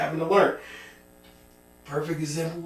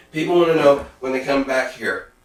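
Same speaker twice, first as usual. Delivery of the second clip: ordinary talk, nothing marked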